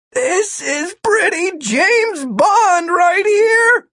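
high, drawn-out excited cry; exhilarated mid-fall thought; thrilled exclamation. A narrator's reading. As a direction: crow it slowly at a high pitch. Martin's thrilled thought while falling.

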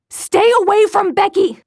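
very high, loud shriek; mother shrieking to keep him from his sister; shrieking warning. Acted. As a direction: shriek it in raw panic.

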